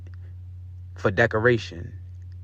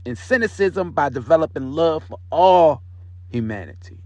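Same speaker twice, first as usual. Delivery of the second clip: higher, louder affirmation read with conviction; 4 semitones higher than usual. Shown as a demonstration. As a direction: proclaim each line like you believe it. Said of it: "I say it out loud, you repeat it after me".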